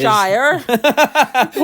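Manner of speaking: loud American-style Wiltshire, ending in "shire" like "fire"; mock American accent. Imitating Americans saying Wiltshire, loud mock American accent. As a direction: say Wiltshire as an American would, "shy-er". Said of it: "when the Americans say".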